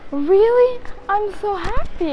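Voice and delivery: high-pitched imitation of a thrilled sister; mock squeal of happiness; overjoyed. Sister's happy reaction, acted out.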